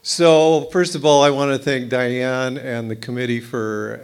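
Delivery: speaking up. Real, not acted. Speaker to the meeting, projecting once the mic works. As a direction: project to the whole room. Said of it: "You can't hear me?".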